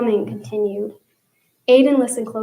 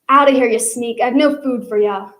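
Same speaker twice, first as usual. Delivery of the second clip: put-on louder hollering, accented; hollering cook; 6 dB louder than usual. Cook hollers at an unseen sneak, loud. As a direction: shoo off an intruder with a yell. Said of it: "the voice hollered".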